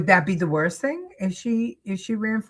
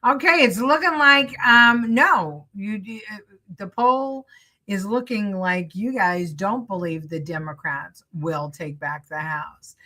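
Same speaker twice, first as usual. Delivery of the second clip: usual speaking voice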